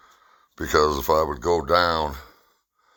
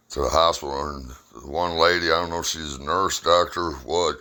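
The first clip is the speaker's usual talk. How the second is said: ordinary delivery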